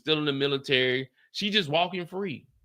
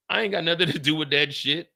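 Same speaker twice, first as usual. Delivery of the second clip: usual speaking voice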